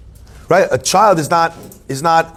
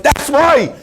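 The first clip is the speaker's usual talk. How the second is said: high-pitched acted eureka cry; excited realization; 11 semitones higher than usual. Reader finally finding the answer, excited retold outburst. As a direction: burst out with it, pitched way up. Acting out his sudden realization.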